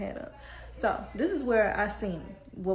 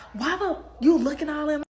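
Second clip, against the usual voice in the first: louder, higher angry outburst; going off; 6 semitones higher, 5 dB louder than usual. Retold or imitated. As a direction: blow up at her. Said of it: "This girl went off".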